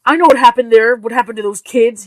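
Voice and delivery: husband hitting back at his wife, loud; retorting. Acted. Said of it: "he retorted".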